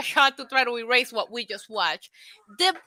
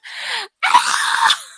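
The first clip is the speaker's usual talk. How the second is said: very high, loud burst of laughter; a sudden squealing laugh; shrieking laugh; 5 dB louder than usual. Real, not acted. Laughing at viewer super chat.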